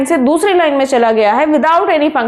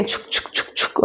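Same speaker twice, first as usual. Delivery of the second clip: breathy whispered "छुक छुक छुक छुक" like a train; whispered choo-choo rhythm; whispered train chugging; 10 dB quieter than usual. Shown as a demonstration. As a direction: puff it out like a toy train. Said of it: "कैसे चलती है train?".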